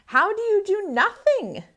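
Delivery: put-on girl puzzled by the lizard; amazed question. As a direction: ask it in wide-eyed wonder. Layla asks the lizard, amazed.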